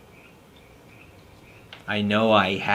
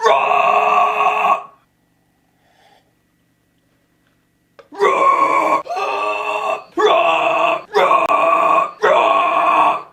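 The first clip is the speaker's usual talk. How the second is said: screamed roar, much higher and louder; monster roar; 7 dB louder, much slower than usual. Acted. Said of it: "Why do I keep saying rar".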